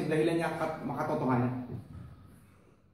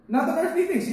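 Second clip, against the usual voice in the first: higher, pressing repeat of "Not on earthly things"; emphatic repeat; 7 semitones higher than usual. Shown as a demonstration. Pastor repeating the verse to the church, higher and pressing. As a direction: lift it and drive it home. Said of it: "repeats verse higher".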